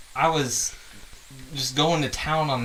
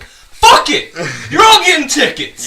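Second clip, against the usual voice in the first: loud, high furious yell; angry cop shout; 12 semitones higher, 12 dB louder than usual. Retold or imitated. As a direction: blow up at the crowd and hand out tickets. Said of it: "I was just like".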